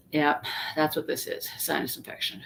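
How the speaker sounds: muttered under her breath; low, almost whispered self-talk; muttering to herself. Retold or imitated. Retold private thought.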